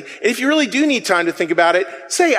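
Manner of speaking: ordinary talk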